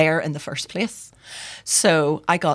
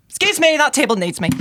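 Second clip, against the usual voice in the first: higher, louder mock getaway line; flustered excuse; 10 semitones higher, 5 dB louder than usual. Waitress escaping customers after botching the specials, flustered. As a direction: blurt an excuse to escape, pitched up.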